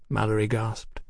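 usual speaking voice

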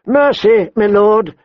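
high-pitched plea for mercy; calling out. A narrator's reading. Woman surrendering to the young lord, calling out. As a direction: call out to surrender, pleading. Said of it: "she called to Robb".

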